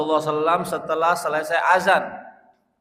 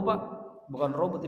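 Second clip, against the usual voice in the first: normal talking voice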